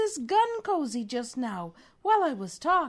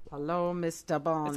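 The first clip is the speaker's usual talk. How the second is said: low villain, hint of German; attempted German villain; 10 semitones lower than usual. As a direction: greet like a German mastermind, pitched down. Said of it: "Are you German now?".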